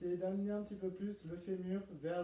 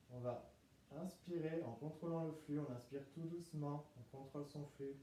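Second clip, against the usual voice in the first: guided meditation; 2 semitones lower, 7 dB quieter than usual. Acted. Leads breathing in relaxation.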